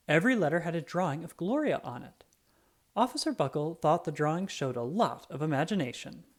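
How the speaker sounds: usual speaking voice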